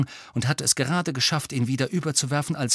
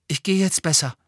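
hasty; 7 semitones higher, 4 dB louder than usual. A narrator's reading. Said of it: "sagte er hastig".

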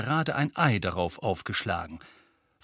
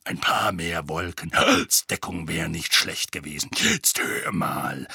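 barking Moody; 7 semitones lower than usual. A narrator's reading. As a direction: snap it low at Harry. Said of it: "blaffte er Harry an".

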